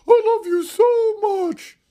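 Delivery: high, slow, lovesick falsetto mock; mocking lovesick man. Mocking a grown man's love talk in high falsetto. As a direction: sneer at a grown man gushing like a teenager. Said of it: "for you as a full-grown man to go".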